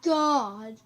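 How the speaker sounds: very loud, drawn-out yell; sudden screaming. Shown as a demonstration. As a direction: burst into a long shout. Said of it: "start screaming".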